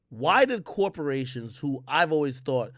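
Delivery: ordinary delivery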